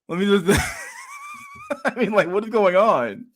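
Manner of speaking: cracking up at the absurd photo; laughing. Real, not acted. Cracking up at the picture.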